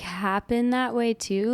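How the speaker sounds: ordinary delivery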